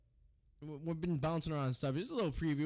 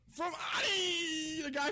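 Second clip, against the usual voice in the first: excited shout; 12 semitones higher, slower than usual. Real, not acted. Hyping own prank call.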